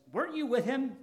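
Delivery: put-on a high, pointed question from a servant girl; servant girl's question. Servant girl accusing Peter at the fire, high pointed question.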